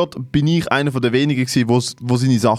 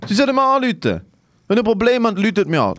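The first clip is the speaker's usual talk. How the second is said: mock urgent appeal; 9 semitones higher than usual. Jokingly calling on listeners.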